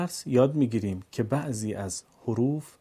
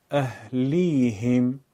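اهلیهم in one slow, unbroken flow; joined-up reading; 4 dB louder, much slower than usual. Shown as a demonstration. Teacher puts the pieces together: اهلیهم drawn out as one word. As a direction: run اهلیهم together, stretching it without pauses. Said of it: "پس از اون به صورت پیوسته قرائت کنید".

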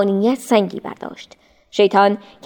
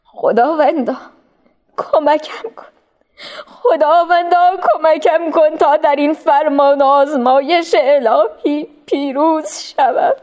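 higher, slow pleading cry to God; begging heaven through tears; slow weeping prayer; tearful prayer; 7 semitones higher, slower than usual. A narrator's reading. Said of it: "رو به آسمان کرد و گفت".

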